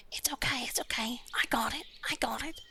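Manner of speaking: very high, soft whispered comfort; high soothing whisper. Acted. Chimney comforts his weeping lordship in a high whisper. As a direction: hush him like a crying child. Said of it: "walks up to his lordship and goes".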